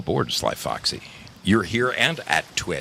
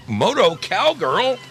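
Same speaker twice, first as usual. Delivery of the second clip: high called-out name; 5 dB louder, slower than usual. Acted. Calling out viewer name.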